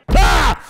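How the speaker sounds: sharp startled shout at the jump scare; startled yelp. Real, not acted. Gamer jolted by the jump scare, startled yelp. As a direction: yelp in real fright.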